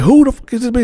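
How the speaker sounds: put-on high, loud demanding girlfriend voice; imitating a furious girlfriend; angry girlfriend. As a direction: confront him over the photos.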